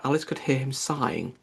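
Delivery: plain reading voice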